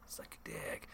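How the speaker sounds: whispered under the breath; whispered insult. Retold or imitated. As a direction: hiss it low and rude. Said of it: "she's like".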